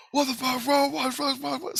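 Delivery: very high-pitched mock panic; flustered scientist. Scientist runs out at the commotion, flustered mock squawk. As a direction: imitate a man rushing out bewildered.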